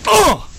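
explosive high effort cry; tennis grunt. Pretend. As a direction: let out a big mock tennis grunt. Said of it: "This is mine".

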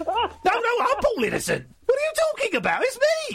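very high, silly caricature; Paul Innocent. Acted. Host insisting he's the real caller, very high mock voice. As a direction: push it up into a squeaky impersonation. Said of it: "I can do an impression of you".

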